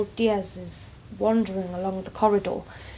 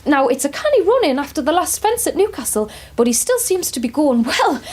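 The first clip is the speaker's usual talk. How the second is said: usual speaking voice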